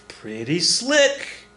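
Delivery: "pretty slick" very slow, rising high, in full voice; savouring. Real, not acted. Teacher admiring the blind pig trick, savouring it slowly. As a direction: stretch out "slick" with relish.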